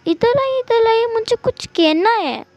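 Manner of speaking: put-on high-pitched little child; child with a secret. Child calling father close to tell something, childlike. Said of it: "yuan ... wing के पास गया".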